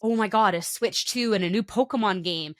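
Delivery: ordinary delivery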